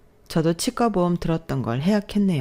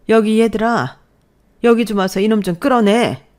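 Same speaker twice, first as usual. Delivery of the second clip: loud call across the room; mother yelling for the nephews; 4 semitones higher, 5 dB louder than usual. Acted. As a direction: summon the boys to drag him out. Mother calls nephews over.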